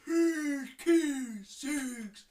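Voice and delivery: loud slow-motion bellow, sinking; very drawn-out winding-down roar. Acted. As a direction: drag each word out, sliding down. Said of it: "keeps on going lower and lower and lower".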